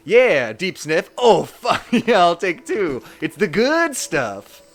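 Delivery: loud, slow thirties gangster patter. Acted. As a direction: play a gangster relishing the goods, drawn out. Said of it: "talks like a thirties gangsters".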